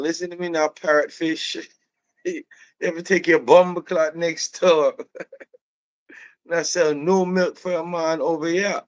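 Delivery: high, scolding Jamaican rant; Jamaican accent. Retold or imitated. Employee refusing the customer service, high Jamaican rant. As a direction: send them away in hammed-up Jamaican, laughs breaking in. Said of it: "The Jamaicans call gays fish".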